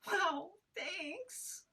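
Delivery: high sarcastic wail; a bitter "thanks" while dangling. Acted. Sarcastic panicked character.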